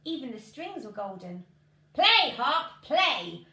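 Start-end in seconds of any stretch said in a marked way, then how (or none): 1.9-3.4 loud booming shout; giant shouting. A narrator's reading. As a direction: bellow the order at the harp. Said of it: "shouted the giant".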